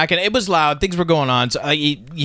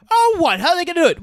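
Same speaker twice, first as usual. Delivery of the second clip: mock guard; 13 semitones higher, faster than usual. Quoting a Holy Grail castle guard, high and incredulous. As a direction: squeak out the outrage.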